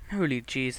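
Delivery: usual speaking voice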